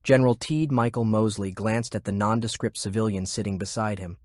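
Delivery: plain reading voice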